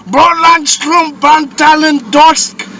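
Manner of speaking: loud, high, slow gibberish German; mock German. A friend joins in with loud, slow fake German. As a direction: spit out fake German aggressively. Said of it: "Everything in German sounds so aggressive".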